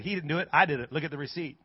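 low, soft matter-of-fact correction; imitated unimpressed neighbor; calm rebuttal. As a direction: set her straight quietly. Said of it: "And he said".

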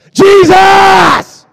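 full-throated high shout of Jesus!; crying out. Shown as a demonstration. Preacher showing the room how to cry out, shout. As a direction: cry out Jesus! with everything you have. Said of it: "Just cry out".

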